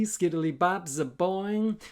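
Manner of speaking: plain reading voice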